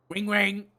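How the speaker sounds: high, sing-out mock phone ring; phone ring imitation. Caller starting the emergency role-play, imitated ringing.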